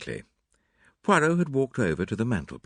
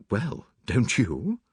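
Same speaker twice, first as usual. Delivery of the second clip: hesitant, drawn-out surprise; taken aback; 3 semitones higher, much slower than usual. A narrator's reading. Hastings answers Mary, slowly, taken aback. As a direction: turn the question back slowly, mildly startled. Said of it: "I said, rather taken aback".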